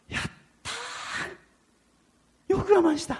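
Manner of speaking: high, breathy shout of relief; joyful cheer. Retold or imitated. Driver praising himself after the drunk leaves, high and joyful. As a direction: cheer that you held out.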